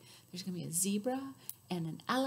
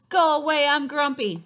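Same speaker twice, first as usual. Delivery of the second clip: loud grumpy shout; grumpy yell; 4 semitones higher, 12 dB louder than usual. Acted. Turtle yelling from his shell, loud grumpy shout. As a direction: grump him away. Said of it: "says Turtle".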